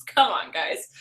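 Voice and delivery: usual speaking voice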